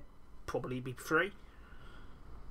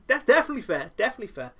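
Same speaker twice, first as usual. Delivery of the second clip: eager agreement; 7 semitones higher, 7 dB louder, much faster than usual. Retold or imitated. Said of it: "Bobby Fish is like".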